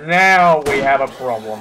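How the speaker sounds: loud, slow warning; alarm shout. Real, not acted. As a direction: call out the danger slowly. Zombie horde approaching.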